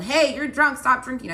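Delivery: loud, high demonstration of yelling; mock yell. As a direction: call it out loud, as if exaggerating for effect. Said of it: "It's not like I yelled".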